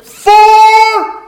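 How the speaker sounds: long high yell across the course; shouting a golf warning to the course. Retold or imitated. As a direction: call it out across the fairway. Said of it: "you'd have to shout".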